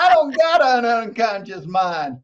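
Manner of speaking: hammed-up Texas accent, drawn out slowly; Texan accent. Coach mimicking a Texan client in a slow Texas accent. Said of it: "He spoke with an accent".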